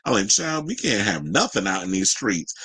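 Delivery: normal talking voice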